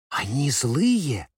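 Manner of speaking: slow, timid, a touch shaky; trembling voice. Acted. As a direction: ask it slowly and timidly. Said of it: "дрожащим голосом спросила девочка".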